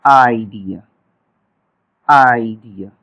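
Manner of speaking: आइडिया said low and drawn out; आइडिया slow and low for the class; demo word. Shown as a demonstration. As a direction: model आइडिया with the final vowel clear. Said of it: "Finally में देखें".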